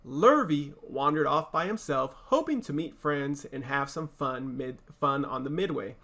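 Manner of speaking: plain reading voice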